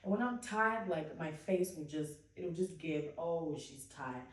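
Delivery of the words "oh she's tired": ordinary talk, nothing marked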